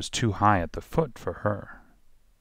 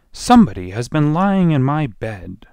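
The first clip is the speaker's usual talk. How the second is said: lightly voiced middle bear, higher and louder; 6 semitones higher, 7 dB louder than usual. A narrator's reading. Middle bear finds his bed used, loud middle voice. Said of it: "in his middle voice".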